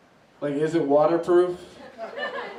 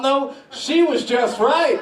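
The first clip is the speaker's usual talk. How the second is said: drawn-out high punchline; slow punchline; 9 semitones higher, 6 dB louder, slower than usual. Retold or imitated. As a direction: land it slow and higher. Punchline of quoted pickup line.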